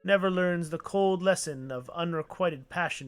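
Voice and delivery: put-on theatrical elderly gentleman; old photographer. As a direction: play a pompous old man courting a helper. Voicing old photographer NPC.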